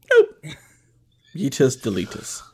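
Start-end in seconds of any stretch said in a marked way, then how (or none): 0.1-0.6 high, squeaked nope; high squeaky nope. Real, not acted. Refusal repeated.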